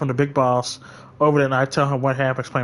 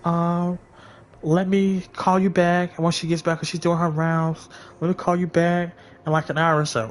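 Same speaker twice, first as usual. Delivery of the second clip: imitated stalling supervisor; 5 semitones higher than usual. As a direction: stall the caller in a higher voice. Voices supervisor putting her off.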